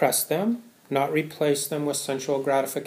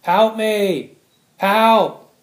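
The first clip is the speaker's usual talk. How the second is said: loud, high desperate calls of help; imitated cry for help; 8 semitones higher, 10 dB louder, much slower than usual. Imitating a nursing home resident yelling for help, loud and high. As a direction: yell it out like the old woman in the corner. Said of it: "only thing she knows how to do is yell for help".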